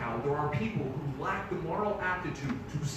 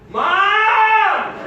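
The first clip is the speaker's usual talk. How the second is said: long, high shout for mom; loud, drawn-out cry for help; child calling out; 13 semitones higher, 12 dB louder than usual. Acted. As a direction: holler like an alarmed little kid. Child calls out for mother.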